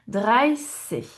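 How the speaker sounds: "Dreißig" with a clear s, no t; pronounced like S. Shown as a demonstration. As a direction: model "Dreißig" with a hissed middle s. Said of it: "ça se prononce comme le S". (« Dreißig » avec un s bien net)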